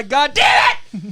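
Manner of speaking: long, high mock-furious shout; built-up outburst. Son imitating his pissed dad, drawn-out shout. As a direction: burst out, dragging the curse. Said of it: "it would build up".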